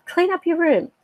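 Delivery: retold insistent order; nagging parent. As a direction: imitate a parent chivvying a child. Parent nagging child in retelling.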